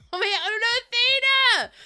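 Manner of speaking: loud, high, drawn-out cry; wailed shout after someone getting away; panicked call. Real, not acted. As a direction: call out in alarm, high and slow. Calling out to lost Athena in game.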